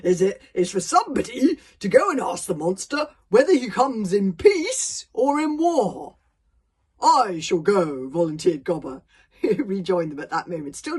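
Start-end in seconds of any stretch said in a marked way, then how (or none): 0.0-6.2 loud chief, slowing for weight; forced authority. Acted. Stoic seeks a volunteer to face the monster, blustering authority. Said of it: "trying to sound as if he was on top of the situation". 7.0-8.1 slow, loud, mock-dignified hero; trying to sound noble. Gobber volunteers to face the dragon, pompous nobility. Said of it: "He was trying to sound noble and dignified".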